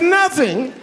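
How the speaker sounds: high shouted rising question; faked surprise. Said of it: "I said".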